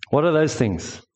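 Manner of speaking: usual speaking voice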